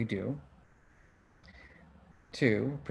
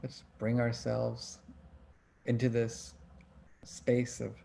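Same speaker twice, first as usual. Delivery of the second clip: guided breathing; 5 dB quieter than usual. Acted. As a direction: ease them into long breaths. Leads a breathing exercise.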